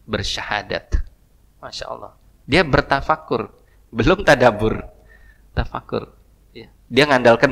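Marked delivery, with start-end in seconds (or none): none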